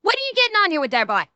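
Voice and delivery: high, quick Newfoundland dialect; Newfie accent. Shown as a demonstration. Streamer showing how Newfies swear, in a Newfie accent. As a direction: invoke and complain in a rapid Irish-sounding Newfie accent. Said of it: "they just kinda, like, go".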